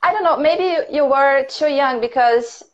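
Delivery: ordinary talk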